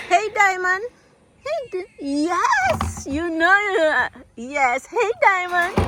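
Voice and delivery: high pet-greeting sing-song; pet talk. Real, not acted. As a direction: coo sweetly to a returning cat. Greeting the cat Diamond.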